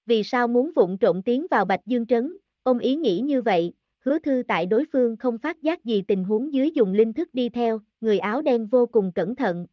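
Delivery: ordinary delivery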